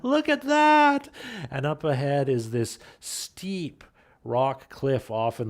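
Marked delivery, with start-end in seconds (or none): 0.0-1.1 put-on high, loud, drawn-out child's cry; excited cry. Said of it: "Hunter cries out".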